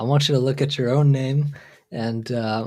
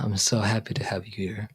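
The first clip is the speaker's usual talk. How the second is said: soft welcoming whisper; 2 semitones lower, 6 dB quieter than usual. Real, not acted. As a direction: whisper it warmly to the viewer. Welcoming a viewer on stream.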